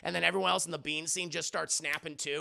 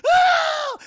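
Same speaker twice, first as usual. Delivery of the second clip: high, loud yelp of pain; 13 dB louder than usual. Retold or imitated. Brad yelping when scalded by coffee. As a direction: shriek as the coffee burns.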